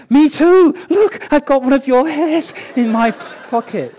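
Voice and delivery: loud, high-pitched excited outburst; overeager. Retold or imitated. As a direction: blurt it out like a thrilled, overkeen husband. Acting out his eager reply to wife.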